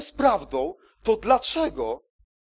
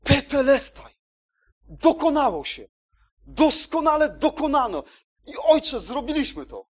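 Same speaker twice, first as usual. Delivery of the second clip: a loud, higher cry of triumph, held for the whole proclamation; 7 semitones higher, 5 dB louder than usual. Retold or imitated. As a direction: proclaim it from the cross as a ringing call. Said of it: "zawołał".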